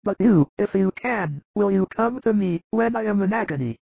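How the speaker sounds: frail high voice, anxious; frightened dying Lazarus. Acted. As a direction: voice a dying man's fear of death. Lazarus begging Jesus.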